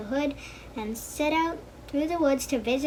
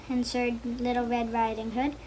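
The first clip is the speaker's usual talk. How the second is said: plain reading voice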